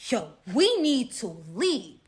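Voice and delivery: slow, emphatic imitation of alarm; mock grave urgency; retold fear. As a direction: draw out each word with urgent weight. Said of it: "I'm seeing real fear".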